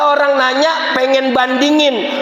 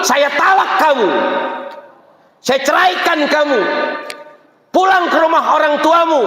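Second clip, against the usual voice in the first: raised, high-pitched mock outburst; angry husband; 6 semitones higher than usual. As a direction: pronounce the divorce like an enraged husband. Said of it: "di akhir marahnya kata suami". (suami marah menjatuhkan talak dengan nada tinggi)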